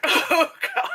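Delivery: high-pitched, overwhelmed little wail; mock wail. Moviegoer reacting to the bereaved father's words, mock wailing. Retold upset reaction to sad scene.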